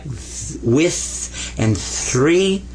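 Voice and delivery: voiceless "th" held in "with" and "three"; lightweight TH. Shown as a demonstration. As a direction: slowly draw out the light TH in "with" and "three". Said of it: "lightweight, like".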